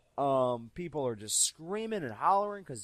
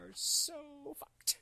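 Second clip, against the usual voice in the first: slow, soft and higher, mock despair; mock doom; 14 semitones higher, 9 dB quieter, much slower than usual. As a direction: let it drag out in hammed-up dread. Caught by Conway with security.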